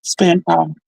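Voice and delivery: plain reading voice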